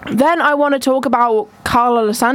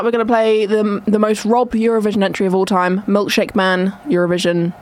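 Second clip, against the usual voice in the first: ordinary talk, nothing marked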